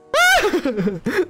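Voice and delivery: high-pitched burst of laughter; laughing. Real, not acted. Own laugh.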